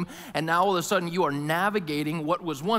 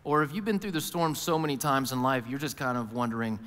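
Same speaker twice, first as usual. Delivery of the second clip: quiet reflective preaching; 3 semitones lower, 4 dB quieter than usual. Acted. Reflective sermon close.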